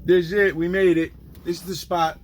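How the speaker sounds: excited. Real, not acted. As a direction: glad to finally be there. Arriving at the spot.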